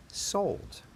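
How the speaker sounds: a hushed mock auctioneer's "Sold"; whispered sold. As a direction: breathe the word out like a playful auction close. Mock auction, going once, twice.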